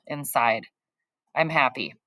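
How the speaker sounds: plain reading voice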